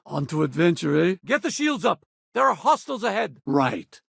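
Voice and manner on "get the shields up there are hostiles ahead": high-pitched battle order; urgent warning. Acted. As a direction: call the alarm to the crew. Warns crew of hostiles.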